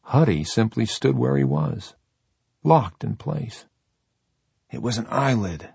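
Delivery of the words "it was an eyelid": hoarse and low, slightly husky. Acted. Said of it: "in a low, hoarse voice".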